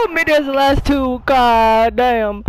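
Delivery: high, loud, elated exclamation; thrilled relief. Real, not acted. Player made it as the last two, thrilled relief. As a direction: let the excitement spill out, loud. Relief after narrowly qualifying.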